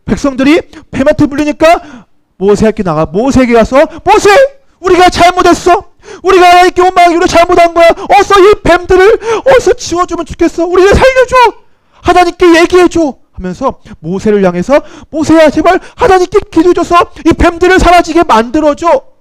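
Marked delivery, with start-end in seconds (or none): 4.0-13.2 high, urgent begging shout; loud frantic plea for help; desperate pleading cry. Retold or imitated. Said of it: "울부짖으며 소리치기". 15.1-19.1 high, urgent begging shout; desperate pleading cry. Retold or imitated. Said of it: "울부짖으며 소리치기".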